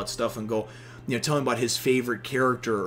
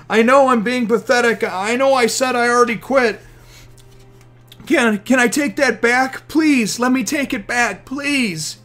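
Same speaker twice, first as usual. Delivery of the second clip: higher, louder desperate plea; high pleading begging; 10 semitones higher, 7 dB louder than usual. Retold or imitated. Usopp begging the crew to take him back, high tearful plea. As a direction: beg them to take you back.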